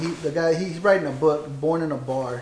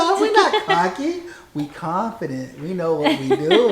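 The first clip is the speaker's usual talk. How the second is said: cocky correction; 3 semitones higher, 6 dB louder, slower than usual. Retold or imitated. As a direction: playfully set his wife straight. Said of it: "I said".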